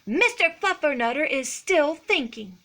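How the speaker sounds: high, loud motherly refusal; firm mother. A narrator's reading. Mama answers Jujubee about grounded Skippy, firm and emphatic. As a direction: say it as a pointed no.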